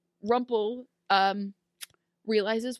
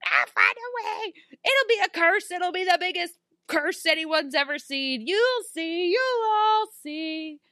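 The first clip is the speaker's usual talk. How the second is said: imitated screaming; 8 semitones higher, 3 dB louder than usual. Father vowing a curse after losing his son, imitated screaming. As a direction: scream a villain's threat in playacted fury. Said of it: "he's just screaming".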